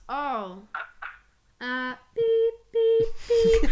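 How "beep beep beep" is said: imitated beeps. Imitating phone disconnect tone.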